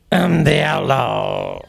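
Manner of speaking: slow comic cowboy sidekick croak; Frog Millhouse voice. Pretend. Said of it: "why don't you do it in your Frog Millhouse voice".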